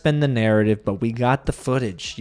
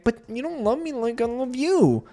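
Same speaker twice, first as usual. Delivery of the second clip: high, whiny mock plea; mock drunk whine; 11 semitones higher than usual. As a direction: imitate a tipsy girlfriend pleading. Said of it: "wasted and then goes and tells Nick".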